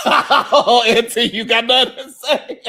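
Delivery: loud words through real laughter; laughing tease. Real, not acted. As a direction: crack up while teasing Auntie. Host cracking up while teasing.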